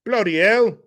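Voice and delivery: loud, high British exclamation; hammed-up British outburst; mock British accent. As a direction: exclaim like a cartoon Brit.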